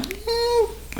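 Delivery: a high, soft, throwaway "Eh."; dismissive shrug. Retold or imitated. Widow answering someone who calls her humor sick, dismissive. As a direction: shrug it off lightly.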